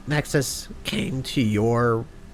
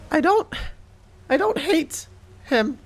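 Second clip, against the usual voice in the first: high, rushed, nervous stammer; a frightened kid tripping over every word; timid stammering character; 3 dB louder than usual. Acted.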